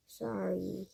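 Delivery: low mumbled grunt. A narrator's reading. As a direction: grunt it under your breath. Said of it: "he grunted".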